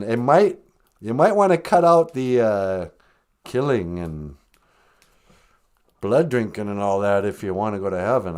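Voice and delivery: ordinary talk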